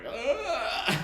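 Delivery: high, loud groan of disgust; disgusted ugh. Retold or imitated. Band watching the student drink, loud disgusted ugh. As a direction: recoil with a big high ugh. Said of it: "everyone's like".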